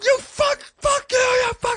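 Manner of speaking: screamed curses, very high and loud; furious yell. Retold or imitated. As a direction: lose it completely, yelling out the car window. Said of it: "I went nuts".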